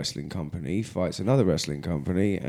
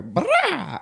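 sudden high yelp like the singer; band shout imitation. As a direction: throw out a high mock metal "Brah". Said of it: "they'll go".

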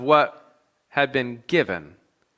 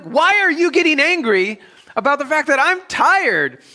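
high, loud, indignant yelp; mock angry retort; 7 dB louder than usual. Husband snapping back at his crying wife, indignant and loud. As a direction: bristle as if she attacked you.

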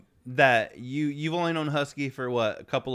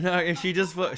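usual speaking voice

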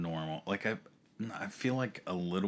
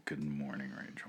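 whispering; 4 semitones lower, 9 dB quieter than usual. Pretend. Greeting matched to her whisper.

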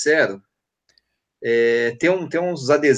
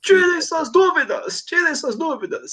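announcer voice; slower than usual. Pretend. Mock announcing a new column.

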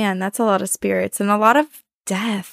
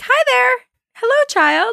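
much higher, bright mock sing-song hello; cheery greeting; 4 dB louder, slower than usual. Woman on the toilet greeting a peeking child, high mock cheer. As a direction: wave the kid off with fake cheer. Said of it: "I've just waved and been like".

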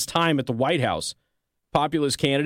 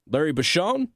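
ordinary talk

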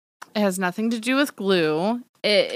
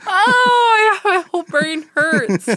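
high, loud wail close to crying; laughing wail; 11 semitones higher, 7 dB louder, slower than usual. Real, not acted. Co-host groaning after the tricks, laughing wail. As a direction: wail helplessly through the laughter.

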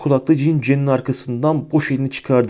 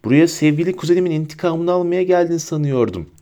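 higher-pitched, taunting character voice; taunting villain; 6 semitones higher than usual. A narrator's reading. Bellatrix taunts Harry about avenging his cousin, taunting. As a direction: needle the enemy in a raised voice.

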